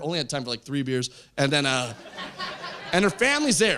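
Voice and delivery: normal talking voice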